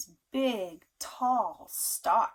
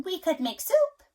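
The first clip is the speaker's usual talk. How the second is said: high, louder hen voice; a cheerful little hen with an idea; little red hen; 9 semitones higher, 9 dB louder than usual. A narrator's reading. Parent reading to child, the hen's high voice. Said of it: "said the little red hen".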